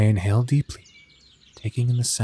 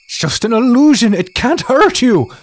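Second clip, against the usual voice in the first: character voice; 13 semitones higher, 8 dB louder than usual. Acted. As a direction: insist firmly that it's an illusion. Character calms a panicking friend.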